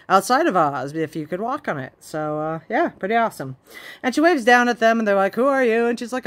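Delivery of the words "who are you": raised, louder mock-curious question; higher, louder mock demand; retold call. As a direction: call it up to her as a retold quote. Retold characters calling up to tower.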